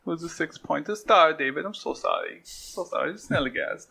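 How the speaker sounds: simpering high German accent, hammed up; creepy German accent. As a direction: put on a creepy, high-pitched German apology. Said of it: "like, the creepy".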